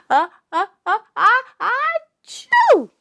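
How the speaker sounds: big rising build into a sneeze; hammed-up sneeze ending in a high yelp; fake sneeze. Song lyric: somebody sneezed.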